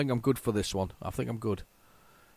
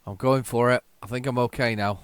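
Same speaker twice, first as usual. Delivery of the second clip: usual speaking voice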